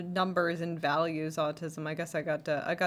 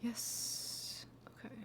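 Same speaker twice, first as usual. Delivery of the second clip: whisper; 5 semitones higher, 11 dB quieter, slower than usual. Real, not acted. Quietly editing to herself.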